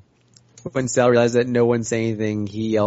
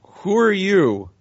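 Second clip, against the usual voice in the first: raised pitch, drawn-out call; yelling up; 6 semitones higher, much slower than usual. Acted. Sal challenges whoever controls the robot birds, yelling up. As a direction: call up slowly to someone overhead. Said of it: "Sal yells up".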